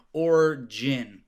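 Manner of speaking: origin said slowly as OR-jin; sound-alike demonstration. Shown as a demonstration. Local compares Oregon for the host, deliberate origin. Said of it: "it's almost like".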